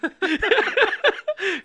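big high-pitched laugh; loud high laugh. Real, not acted. Loud laugh after story.

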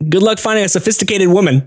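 said through a laugh; mock him while laughing; laughing tease. Officer laughing at the embarrassed boy. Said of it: "she's like".

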